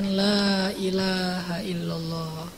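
very slow, soft and low, each syllable drawn out; slow reverent creed. Acted. The Prophet pleading before God, slow and hushed. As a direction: lay the creed down gently as a plea.

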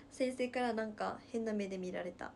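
normal talking voice

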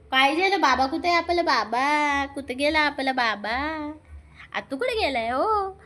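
singsong high pet voice; cooing pet talk. Real, not acted. Baby talk questions to pet.

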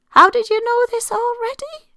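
very high, loud mock surprise; mock astonishment. Teasing the listener who knew already, mock surprise. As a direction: gasp in playful disbelief.

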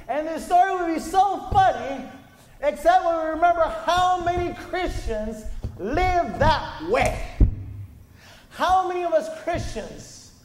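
preacher's full-force climax; preaching at full force. Acted. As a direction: drive the point home to the congregation.

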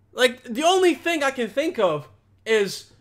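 normal talking voice

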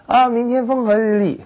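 mock the forecast you want to hear; delighted forecast. Picnic-goer imagining the perfect forecast, gleeful. Hoping for good picnic weather.

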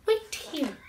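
high little bird voice; bird character. A narrator's reading. Bird tells Cat to wait, high voice. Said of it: "Bird said".

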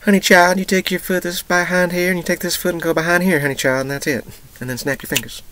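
high, loud Southern mama voice, a mock imitation. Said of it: "making my mama sound more southern".

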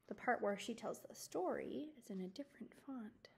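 soft unvoiced whisper; quiet whispered note. Real, not acted. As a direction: lean in and whisper the aside. Aside about the book's font.

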